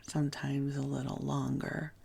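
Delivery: ordinary delivery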